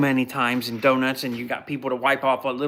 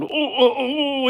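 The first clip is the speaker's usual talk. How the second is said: mock pained groans; 12 semitones higher, slower than usual. Imitates groaning after punch.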